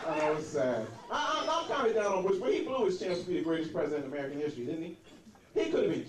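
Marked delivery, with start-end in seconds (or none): none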